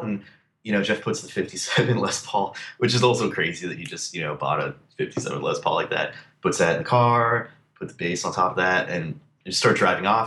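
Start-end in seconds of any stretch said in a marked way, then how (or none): none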